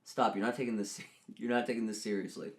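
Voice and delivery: normal talking voice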